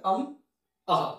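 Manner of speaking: আম as a short "am" closed on a hum, আহা as "aha" with an H echo; anusvara and visarga sounds. Shown as a demonstration. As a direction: sound out আম, then আহা, each on its own. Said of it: "এইভাবে বলা হয়ে থাকে".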